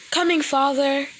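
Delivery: high, sweet daughter call; high girl voice. Acted. Daughter answers father.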